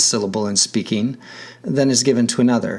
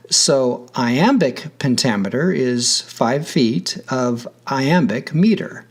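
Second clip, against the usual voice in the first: normal talking voice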